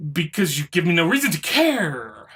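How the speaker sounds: ordinary talk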